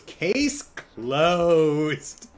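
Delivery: smug declaration. Retold or imitated. Madison declaring in court.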